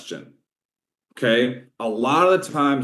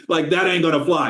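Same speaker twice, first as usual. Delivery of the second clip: ordinary talk, nothing marked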